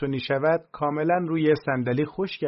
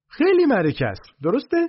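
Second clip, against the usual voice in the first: put-on high, fast, squeaky pitch for a pushy young salesman; brash used-car salesman voice, high and squeaky; 6 semitones higher, 5 dB louder than usual. A narrator's reading. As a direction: overeager hustler squeaking his pitch at the boss. Salesman voice pushing his pitch.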